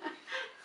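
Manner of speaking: a sudden high laugh at the teasing; high burst of laughter. Real, not acted. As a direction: laugh it off, caught out. Laughs at being teased.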